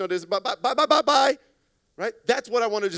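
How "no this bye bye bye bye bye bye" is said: rapid-fire goodbyes getting louder and higher; hurried brush-off. Acted. Customer escaping a sales call, hurried.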